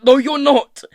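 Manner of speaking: high, very loud contradiction; forceful, heated yell; angry shout. A narrator's reading. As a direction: shout back to deny it. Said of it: "his own voice rising to a shout".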